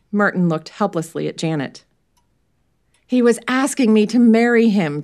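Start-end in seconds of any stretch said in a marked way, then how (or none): 3.1-5.0 higher, louder impatient finish; exasperated. Acted. Janet finishing Merton's sentence, exasperated. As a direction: finish it for him, fed up. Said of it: "Janet finished, exasperated".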